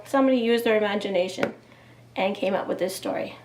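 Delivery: ordinary talk, nothing marked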